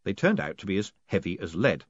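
ordinary delivery, nothing marked

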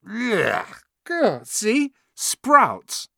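high, disgusted yelps; spluttering in disgust at a bad bean. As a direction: spit out the awful taste. Ron bites a sprout bean.

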